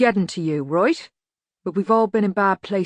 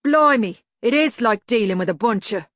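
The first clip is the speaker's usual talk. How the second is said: loud burst easing into grumbling; exasperated; 4 semitones higher, 5 dB louder than usual. A narrator's reading. Medium Dave fed up with the gang, exasperated outburst. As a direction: start high and loud, fed up.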